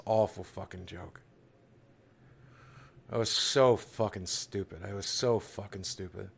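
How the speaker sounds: low, soft, heavy with regret; sinking into hushed, low remorse; quiet self-reproach. Real, not acted. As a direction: blame yourself under your breath. Regrets joke about missing friend.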